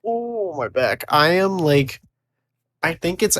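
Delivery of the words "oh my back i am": usual speaking voice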